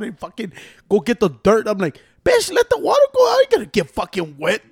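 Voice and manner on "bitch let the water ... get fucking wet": defiant yell. Retold or imitated. Refusing older brother in rain.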